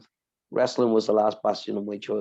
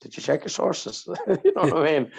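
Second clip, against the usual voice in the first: usual speaking voice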